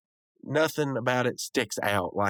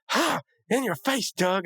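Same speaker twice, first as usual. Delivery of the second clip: high-pitched mock crowing; gloating taunt; 13 semitones higher, slower than usual. Rigby rubbing his win in Doug's face, gloating. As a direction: crow it like a cartoon winner. Acted gloating in retold cartoon.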